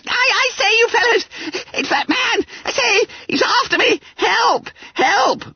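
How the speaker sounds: very high, squealing cries for help; panicked schoolboy squeal; Billy Bunter. A narrator's reading. Frightened schoolboy telling his dormitory a man is after him, panicked squeal. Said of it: "Loudest of all were the dulcet tones of William George Bunter".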